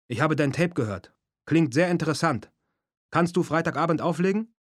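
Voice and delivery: put-on loud, high-pitched Japanese-accented voice; punchy phone message in a Japanese accent; broad Japanese accent. A narrator's reading. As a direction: play a caller with a thick Japanese accent. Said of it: "mit einem breiten japanischen Akzent".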